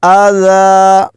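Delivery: slow, high, melodic tilawat; Quran recitation. Shown as a demonstration. Teacher reciting the passage for students, slow melodic chant. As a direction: chant the verse in long drawn melody. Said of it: "اس طرح".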